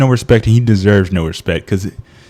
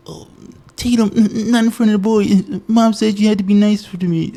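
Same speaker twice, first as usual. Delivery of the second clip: put-on much higher, pleading little-boy voice; whiny Dewey impression; 10 semitones higher than usual. Mocking Dewey begging his sister Tatum, high whine. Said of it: "Dewey's like".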